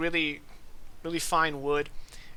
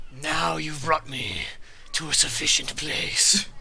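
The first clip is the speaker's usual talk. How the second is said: talking sword; slower than usual. Acted.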